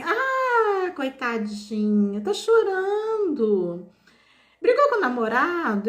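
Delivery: high, slow, pitying sing-song; imitating old lady. Begging old woman pities the crying speaker, high pitying coo. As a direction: coo over her like a kind old woman. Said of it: "ela falou assim".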